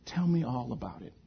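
very soft, patient invitation; gentle listener. Retold or imitated. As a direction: invite them to confide, hushed and tender. Jesus gently invites them to talk.

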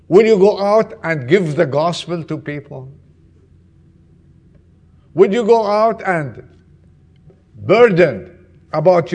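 loud, urgent sermon telling; fervent preaching. Acted. Pastor to congregation, loud fervent preaching. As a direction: preach it out to the whole church.

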